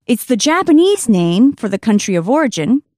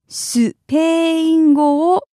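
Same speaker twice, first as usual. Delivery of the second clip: "Spain go" drawn out as Japanese "su-peeein gooo", higher; slow repeat; 4 semitones higher than usual. Shown as a demonstration. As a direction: stretch the vowels of "Spain go", Japanese-style. Teacher repeats phrase slowly.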